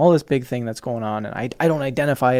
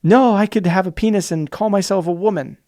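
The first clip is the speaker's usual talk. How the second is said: mild mock indignation, louder and a bit higher; a touch of hammed-up defiance; mock insistent; 5 semitones higher, 6 dB louder than usual. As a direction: lightly caricature someone fighting hard. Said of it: "fighting so aggressively hard to be like".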